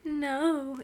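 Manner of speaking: drawn-out, mildly moaned protest. A narrator's reading. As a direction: read it as an understated moan. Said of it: "Edith moaned".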